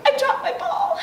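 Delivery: high, mock-sobbing little voice; tearful. A narrator's reading. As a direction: speak up high as if just done crying. Princess still tearful.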